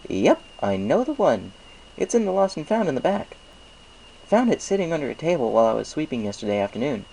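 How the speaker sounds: high, friendly shopkeeper pony voice; chirpy baker pleased with business; Mister Cake's voice. A narrator's reading. Baker thanks Applejack for the apples, chirpy and friendly. Mister Cake recalls the bag.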